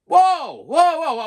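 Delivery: loud, high protesting whoas; acted high-pitched yell; retold yelling. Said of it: "I'm yelling".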